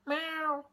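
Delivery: high mock meow like a cat; playful cat meow. Shown as a demonstration. Cat in gato example.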